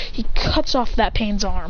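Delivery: usual speaking voice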